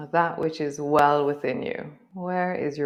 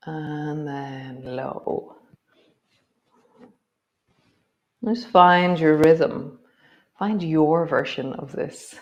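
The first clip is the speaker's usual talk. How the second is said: guided movement cue. Acted. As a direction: guide the body gently through each move. Leads a guided movement practice.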